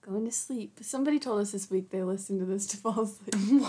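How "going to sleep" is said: sleepy hushed whisper. Pretend. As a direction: breathe it like drifting off. Joking listeners fall asleep.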